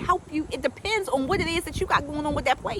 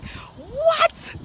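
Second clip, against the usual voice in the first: high-pitched "what?" of delight; mock amazement. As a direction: squeal it in exaggerated disbelief. Mock amazement at remembered cookies.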